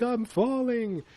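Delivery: hammed-up cry of a falling giant; mock falling cry. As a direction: stretch it out like someone dropping away. Voices Kong falling down the vortex.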